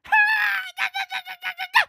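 very high stuttering cackle; shrieking cackle. Acted. Comedian mocking an oversharing father, shrill cackle. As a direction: let out a high, rattling shriek of laughter. Outburst amid mocking the poster.

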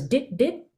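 "dit, dit" as two light, short drops; raindrop articulation. Shown as a demonstration. Teacher likening notes to raindrops on a leaf, "dit" light and short. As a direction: bounce each "dit" lightly and cut it short. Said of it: "a raindrop bouncing off a leaf".

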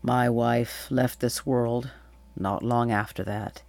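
grieving king's subdued confession; low, remorseful. A narrator's reading. King tells Atalanta of searching for his lost child, sorrowful remorse. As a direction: let regret weigh down every phrase, pitched a little low. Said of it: "low voice, heavy with remorse".

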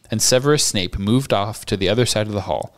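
usual speaking voice

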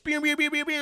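high playful pew pew; laser pew sounds. Acted. Goofing around with the co-host, high pew noises. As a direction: fire off toy laser noises.

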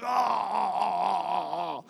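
drawn-out muffled "aah" of pain; long pained groan. Acted. Worker who stubbed a toe on the door ledge, stifled groan. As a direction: hold back a long cry of pain.

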